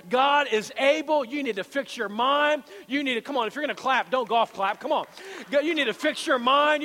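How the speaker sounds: loud, high, emphatic exhortation; full-force preaching. Real, not acted. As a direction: rouse the congregation, stretching the key words.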